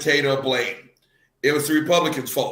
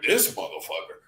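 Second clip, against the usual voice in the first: low, soft mock mutter of disbelief; lightly imitating a stunned co-host, much lower and softer; 11 semitones lower, 6 dB quieter than usual. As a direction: drop way down and grumble it under your breath. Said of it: "Mike Myers was like".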